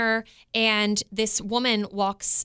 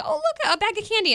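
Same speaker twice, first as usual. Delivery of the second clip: much higher, sing-song surprise; mock delighted dream voice; 12 semitones higher than usual. Mom retelling her dream to her co-host, much higher delighted voice. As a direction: chirp it like finding a treat.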